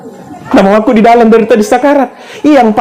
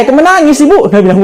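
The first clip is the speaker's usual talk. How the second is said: ordinary talk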